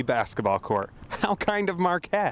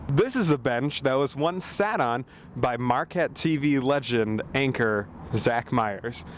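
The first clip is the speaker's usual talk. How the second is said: normal talking voice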